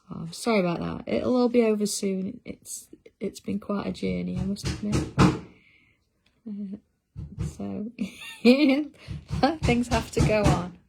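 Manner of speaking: ordinary talk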